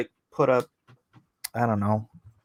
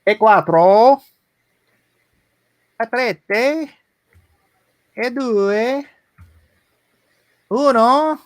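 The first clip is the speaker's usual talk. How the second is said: mock Italian accent; 9 semitones higher, 5 dB louder, slower than usual. Silly countdown in mock accent.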